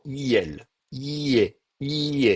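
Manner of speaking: "I, E" sliding into i-ye; glide. Shown as a demonstration. Teacher shows passing from I to E, gliding "I, E". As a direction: stretch the "I" and let it glide into "E". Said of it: "je vais avoir un glissement".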